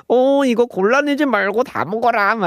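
put-on high-pitched mom urging her kid to eat; nagging mom. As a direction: put on a high, pleading mother voice. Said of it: "sounds like something your mom would say".

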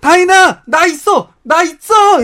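loud, high call to someone inside; retold shout to get attention. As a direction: call out to announce you're here. Retold calling out to sister-in-law.

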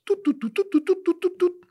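high, slow beeping noise; calculator beep sounds. Acted.

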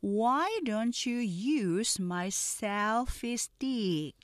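very slow, careful English dialogue; slow pronunciation drill; slow model read. Shown as a demonstration. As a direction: read each line slowly for learners to repeat. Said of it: "느린 속도 발음 듣고 천천히".

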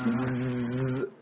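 long buzzing zzz; sustained z buzz. Shown as a demonstration. Teacher has student copy it, sustained z buzz. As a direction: hold a voiced z. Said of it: "zzz 해보세요".

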